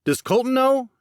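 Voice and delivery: high, loud demand. Acted. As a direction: push him for an answer. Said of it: "Jackson demanded".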